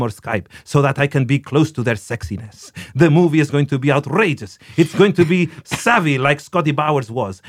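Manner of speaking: put-on Italian accent, reading a quote aloud. Host quoting Luca Guadagnino on his screenwriters, in a put-on Italian accent. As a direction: play an Italian director with an Italian accent. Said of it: "I'm gonna put an Italian accent".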